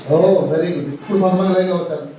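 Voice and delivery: ordinary delivery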